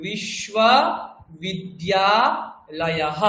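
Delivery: বিশ্ববিদ্যালয়হ drawn out very slowly, every syllable clear, with a breathy হ at the end; teacher slowly modelling বিশ্ববিদ্যালয়হ in Sanskrit, ending with a breathy হ; Sanskrit pronunciation. Shown as a demonstration. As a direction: spell out বিশ্ববিদ্যালয়হ syllable by syllable, very slow, ending on a voiced visarga হ. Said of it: "লয়াহা বলতে হবে".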